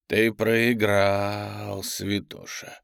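very slow, drawn-out demon voice; drawling demonic gloat. A narrator's reading. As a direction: taunt slowly as a demon, dropping off at the end. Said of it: "сказал демон".

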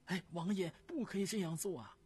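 high, loud, urgent plea; pleading wife. Acted. Wife begs the prince not to write the memorial, high and urgent. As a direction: rush to stop him. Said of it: "急忙阻拦".

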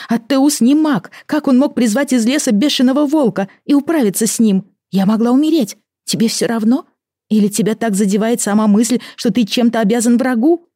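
high, loud and furious; angry wife. Acted. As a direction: hurl it at him in anger. Amy's angry argument with husband. (гневная тирада жены)

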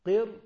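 "قر" hit high and loud, heavy ra; emphatic syllable. Shown as a demonstration. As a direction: punch out "قر" with a full heavy ra. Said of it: "الراء ساكنة اهي".

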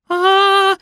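long ecstatic shriek, high and loud; high squeal. A narrator's reading. Nikoletta squealing at the famous designer. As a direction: squeal like a starstruck fan. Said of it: "визжала Николетта".

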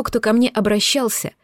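ordinary delivery, nothing marked

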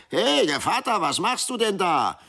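high, loud shrill goblin cry; schrille Stimme. A narrator's reading. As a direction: call out shrill and high across the water. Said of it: "eine schrille Stimme rufen hörte". (schrille, hohe Geisterstimme)

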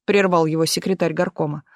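usual speaking voice

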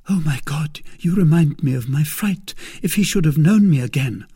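high, loud, anxious maid; frightened maid. Acted. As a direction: recall the fright, building in alarm. Voices Kitty frightened.

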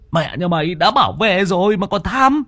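high, loud despairing cry; cursing and wailing in panic; frantic wail. Acted. Friend on the bank wails as Kiếm is sucked under.

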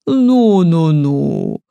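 put-on a touch of a bass old lady; мягко пробасить, осаживая; old woman's deep voice. A narrator's reading. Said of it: "пробасила старуха". (пробасила: «ну, ну, ну»)

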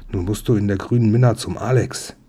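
ordinary delivery

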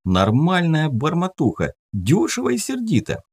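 put-on high breezy young voice; cheeky teen. Teen defending cheap wine.

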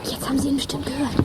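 hushed, fast whisper. Acted. Kid whispering from a hiding place to a friend. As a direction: whisper so the gang won't hear. Kids hiding, spying on gang.

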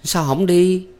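high, impatient prod; urging. A narrator's reading. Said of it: "Thắm lại hối". (giọng cao, hối thúc)

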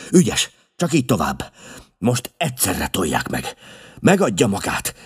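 high, loud rallying calls; pressing, energetic orders; urgent commands. A narrator's reading. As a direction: drive the workers on. Holmes urges men to open coffin.

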